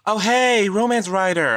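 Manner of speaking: high, eager hello; delighted phone greeting. Pretend. Voice actor to writer on phone, gushingly pleased.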